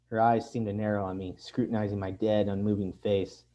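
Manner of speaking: ordinary delivery, nothing marked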